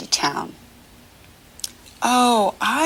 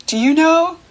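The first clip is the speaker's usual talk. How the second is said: ordinary talk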